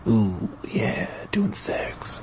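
breathy whisper, voice gone; mock sexy whisper. The reader whispers a joke about the creature's hormones. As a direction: whisper it like a cheap tease. Mocking aside during the reading.

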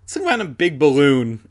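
loud, cocky dismissal; boisterous, mocking confidence; grinning scoff. A narrator's reading. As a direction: say it through a grin. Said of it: "Adam repeated, grinning".

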